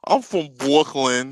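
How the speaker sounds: put-on slow, lightly done Brooklyn accent saying "Brooklyn"; a hint of Brooklyn accent; mock Brooklyn accent. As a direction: tease her Brooklyn accent, slowly. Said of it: "she be cracking me up with that Brooklyn accent".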